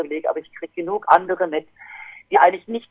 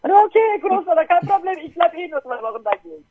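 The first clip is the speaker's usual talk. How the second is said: cheerful reply; 10 semitones higher than usual. Retold or imitated. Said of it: "her reply to grandfather".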